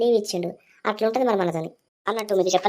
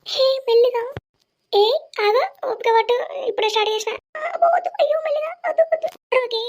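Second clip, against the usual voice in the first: squealing woman. Acted. (రంగుతో కీచుగా అరిచే స్త్రీ గొంతు)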